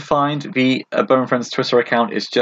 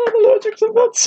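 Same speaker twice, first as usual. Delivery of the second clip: much higher, mock imitation of a friend; mock squeaky quote; 3 dB louder than usual. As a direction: pitch it way up to tease. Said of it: "I thought you were gonna be like".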